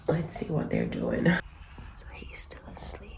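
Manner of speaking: soft unvoiced whisper. Real, not acted. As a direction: whisper so the babies stay asleep. Checking on sleeping babies.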